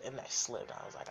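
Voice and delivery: normal talking voice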